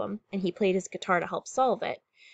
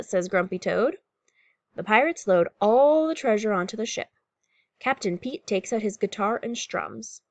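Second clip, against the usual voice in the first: ordinary delivery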